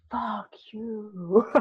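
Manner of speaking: whispered curse. Retold or imitated. Retorting to coach, exhausted.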